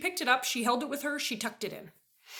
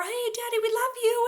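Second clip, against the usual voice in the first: much higher, coaxing "Hey, honey"; sing-song sweet talk; 12 semitones higher than usual. Retold or imitated. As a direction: coo at him like a child. Sweet-talking husband.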